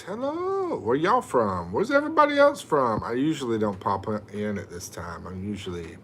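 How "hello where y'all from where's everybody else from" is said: a hint of a squeaky greeter, higher; imitating a viewer. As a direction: lightly mimic the viewer's hello. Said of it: "Mark says".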